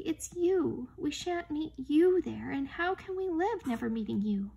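high, distressed pleading; sobbing child's protest. A narrator's reading. Lucy cannot bear losing Aslan, high and tearful. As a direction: plead as if near tears, pitch high. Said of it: "sobbed Lucy".

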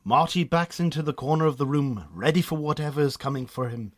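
normal talking voice

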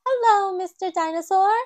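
high, loud happy greeting; Peppa. A narrator's reading. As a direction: greet the toy like an old friend. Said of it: "says Peppa".

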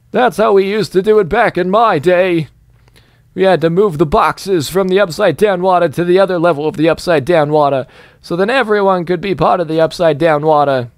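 lightly voiced grandpa spinning yarns; old-timer boasting. Gamer plays an old-timer bragging about box moving.

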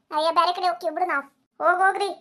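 ordinary delivery

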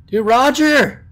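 loud, high, drawn-out hail; excited calling out. Real, not acted. Player greeting returning Roger, high loud call. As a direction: shout a slow, stretched greeting to a buddy.

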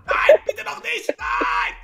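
high, loud cackling outburst; whoop, then plead in mock panic; wild banana character. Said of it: "Die Banane, die geht auch manchmal auf Omme". (durchgedrehte, kreischende Bananenstimme)

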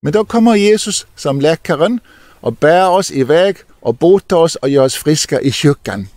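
ordinary talk, nothing marked

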